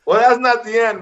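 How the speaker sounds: ordinary delivery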